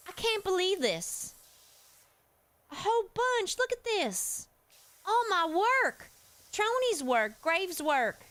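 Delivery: distressed and rattled, voice pushed up; freaking out. Real, not acted. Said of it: "I'm freaking out here".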